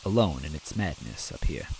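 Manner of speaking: usual speaking voice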